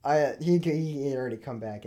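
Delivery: ordinary delivery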